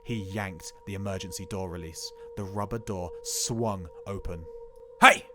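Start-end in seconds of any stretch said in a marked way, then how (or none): 5.0-5.3 sharp yell from the driver's seat; driver shouting. Acted. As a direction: yell it to stop him. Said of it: "the driver shouted".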